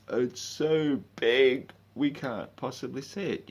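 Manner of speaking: mock a preachy know-it-all; mocking dogmatic voice. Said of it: "listening to some dogmatic".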